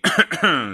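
harsh throat-clearing cough. Real, not acted. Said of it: "scusate la tosse". (colpo di tosse raschiato)

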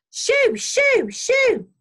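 high, loud shoos; piercing farmer's wife shout; shouted shooing. A narrator's reading. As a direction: shoo the bird off at full voice. Farmer's wife chases jackdaw off.

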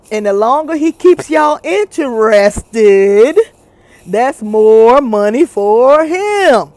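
slow, every key word drawn out; exaggerated dragging delivery; mock slow talking. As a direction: imitate a slow talker milking each word. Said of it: "because if he talks slow".

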